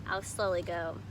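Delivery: usual speaking voice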